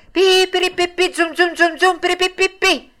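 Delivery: high, loud sung pipe and drum sounds; mock fife and drum. Narrator mimicking fifes and a bass drum, sung high. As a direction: imitate a distant band.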